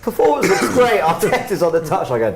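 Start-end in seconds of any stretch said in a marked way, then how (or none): 0.0-1.0 high, loud sarcastic imitation; mock pundit voice. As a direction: put on a fake-cheerful pundit voice. Mocking voice of pundits.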